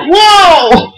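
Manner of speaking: sudden high whoa; a quick real startled exclaim; surprised whoa. Real, not acted. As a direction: caught off guard by something seen. Sudden sight of dogs.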